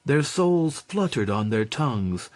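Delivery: usual speaking voice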